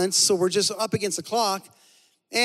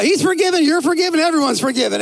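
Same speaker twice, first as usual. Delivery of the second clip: louder, higher, giving-it-all-away host; Oprah impression; 6 semitones higher, 7 dB louder than usual. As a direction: announce it like a talk-show host handing out prizes. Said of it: "I'm like Oprah".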